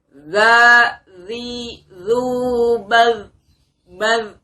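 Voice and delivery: loud, high zal syllables; makhraj drill. Shown as a demonstration. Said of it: "Kita bunyikan makhraj zal sama-sama".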